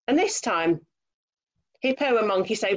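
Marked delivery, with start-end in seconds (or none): none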